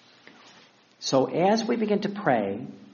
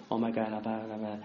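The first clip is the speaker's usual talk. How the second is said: people praying; 5 semitones lower, 7 dB quieter, faster than usual. Retold or imitated. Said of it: "they pray, and they go".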